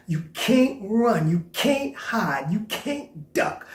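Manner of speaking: each can't leaned on, slow; slow emphatic list. Acted. Preacher telling the congregation they can't escape, slow and emphatic. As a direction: spell it out word by word.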